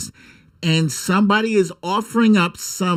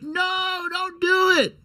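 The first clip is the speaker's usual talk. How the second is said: yelling at the screen; 3 dB quieter than usual. Retold or imitated. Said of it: "You're like".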